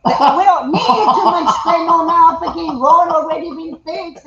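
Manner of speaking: loud, high, laughing outburst; laughing protest. Real, not acted. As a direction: protest loudly through real laughter. Laughing rant about road repairs.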